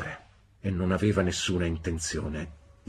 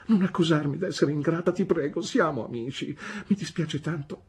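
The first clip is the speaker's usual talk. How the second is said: tearful pleading; 9 semitones higher than usual. A narrator's reading. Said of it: "pianse mia madre".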